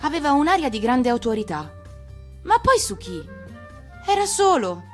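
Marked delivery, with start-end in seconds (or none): none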